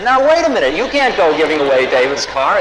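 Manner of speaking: an indignant yelp of protest; alarmed protest. Retold or imitated. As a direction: jump in to stop it, alarmed. Teller protests giving away the car.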